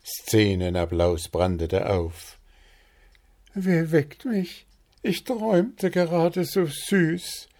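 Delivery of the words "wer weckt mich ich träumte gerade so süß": very high, dreamy falsetto; sleepy princess. A narrator's reading. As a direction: male bird playing Sleeping Beauty, just woken. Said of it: "kämpfte ein unkönigliches Gähnen nieder".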